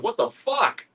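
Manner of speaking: high, incredulous what the fuck; mock disbelief. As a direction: pitch up in disbelief.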